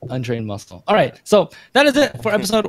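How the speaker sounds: normal talking voice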